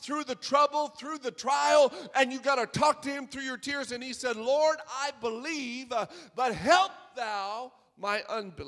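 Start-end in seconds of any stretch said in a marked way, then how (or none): 0.0-3.9 building sermon cry, louder and louder to a shout; preaching at full force. Acted. 4.4-8.6 crying out with tears. A narrator's reading. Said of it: "cried out and said with tears".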